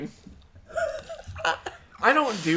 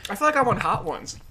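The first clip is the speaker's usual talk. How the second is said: usual speaking voice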